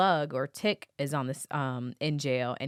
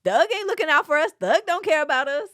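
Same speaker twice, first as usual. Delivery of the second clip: higher, louder complaining voice; inmates' voices; 12 semitones higher, 7 dB louder than usual. Retold or imitated. Host imitating inmates complaining about Thug. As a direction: mimic the griping inmates. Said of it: "you hear them saying".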